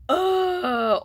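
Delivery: loud, high scornful groan of disgust; mock disgusted ugh from the back; rude ugh. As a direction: groan it out like a bored rude student. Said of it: "some rude voice".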